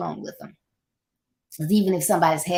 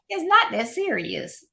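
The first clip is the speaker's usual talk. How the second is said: ordinary delivery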